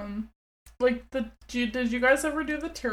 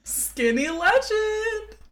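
sassy meme voice; 10 semitones higher, much slower than usual. Real, not acted. Slow high meme quip.

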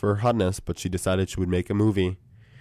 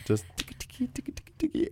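unvoiced ticky ticky, pitched up at the end; whispered typing noises; 13 semitones higher, 11 dB quieter, faster than usual. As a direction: whisper ticky ticky like tapping keys. Imitating typing on a phone.

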